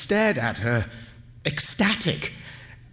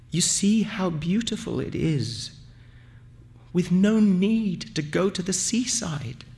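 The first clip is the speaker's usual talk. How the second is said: a touch of tremble, higher pitch; lightly voiced quaver, pitched up; trembling voice; 6 semitones higher than usual. A narrator's reading. Aminta tearfully moved beside the narrator, mildly trembling voice. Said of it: "In a trembling voice".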